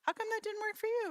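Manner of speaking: high, surprised questioning voice; puzzled asking. Acted. Director puzzled by a colleague's failure, asking high. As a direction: sound honestly baffled it failed.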